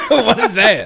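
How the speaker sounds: laughing out the question. Real, not acted. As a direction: burst out laughing mid-question. Laughing at a friend's weird snack.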